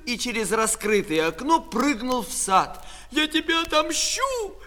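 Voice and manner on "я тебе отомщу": high-pitched threat of revenge; acted high, wailing yell; tearful shout. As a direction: cry out in tearful fury. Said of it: "кричала со слезами Катя".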